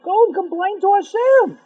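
high, loud acted retort; indignant challenge. Insulted man answering the rabbi, indignant challenge.

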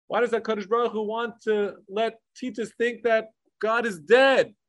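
ordinary talk

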